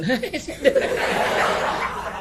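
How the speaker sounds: high, airless cackling laugh; breathless giggle. Retold or imitated. Aunt laughing uncontrollably at mourners, airy cackle. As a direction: wheeze out a helpless laugh.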